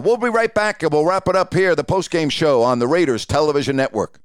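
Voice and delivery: on-air announcer. Retold or imitated. Game host to viewers before the commercial, on-air announcer voice. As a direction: throw to the break like a TV host. Broadcaster throwing to commercial break.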